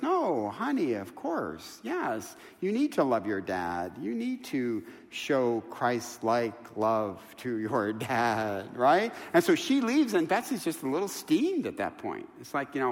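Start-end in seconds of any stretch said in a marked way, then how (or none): 0.0-8.8 soft, tender reassurance; mother reassuring her daughter, soft and tender; gentle mother. Retold or imitated. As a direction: quietly soothe a worried daughter.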